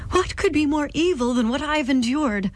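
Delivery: exclaimed. Acted. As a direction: cry it out in grief. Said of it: "the First Lady exclaimed".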